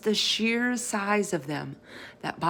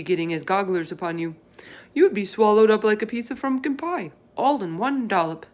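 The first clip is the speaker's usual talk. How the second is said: BFG voice; 2 semitones higher, 3 dB louder, faster than usual. Acted. Giant explaining to Sophie what the others do, folksy. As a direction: play the big friendly giant, chatty and earnest.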